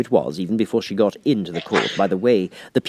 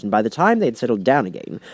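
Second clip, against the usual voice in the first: usual speaking voice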